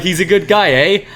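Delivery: a hammed-up Canadian eh; mock Canadian accent, high and loud. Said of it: "you're Canadian?".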